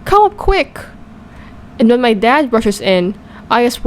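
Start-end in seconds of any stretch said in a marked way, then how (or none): 0.0-0.8 high-pitched call for her husband; mild high yell for help. A narrator's reading. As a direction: call him upstairs urgently, voice pitched way up. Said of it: "And she yells".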